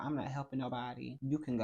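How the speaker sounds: ordinary delivery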